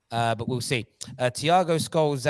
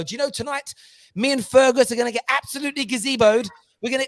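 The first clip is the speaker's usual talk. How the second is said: high-pitched mock posh football fan; posh Chelsea fan; 10 semitones higher, 4 dB louder than usual. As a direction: crow like a posh fan after a trophy. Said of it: "I want a posh Chelsea fan".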